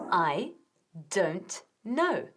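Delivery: each word drawn out slowly; very slow model. Shown as a demonstration. Teacher models the phrase.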